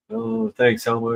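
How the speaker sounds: usual speaking voice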